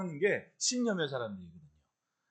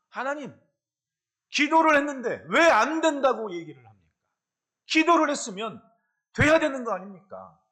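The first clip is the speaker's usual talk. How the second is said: retold frustrated prayer; 5 semitones higher, 9 dB louder than usual. Retold angry prayer to God.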